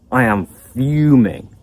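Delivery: sigh, then slow, seething anger; acted fuming. Teacher acting out a cancelled holiday, slow fuming. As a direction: play it slow and simmering with anger. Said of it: "That was pretty good acting".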